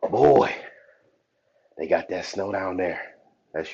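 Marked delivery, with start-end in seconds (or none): none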